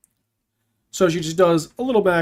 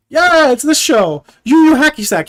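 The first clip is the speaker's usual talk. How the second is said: excited; 5 semitones higher, 6 dB louder than usual. Real, not acted. Excited name recall, joking mangle.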